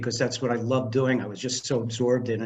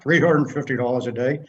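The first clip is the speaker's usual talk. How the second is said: louder, after clearing the throat; forced recovery; 5 dB louder than usual. Retold or imitated. Nervous producer naming his rate after choking, forced recovery. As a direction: pull yourself together and state the rate.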